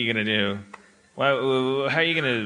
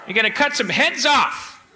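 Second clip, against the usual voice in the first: mock angry rant; 6 semitones higher than usual. Scolding Scotland for not leaving, hammed-up mock fury. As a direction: berate them with laughing mock fury.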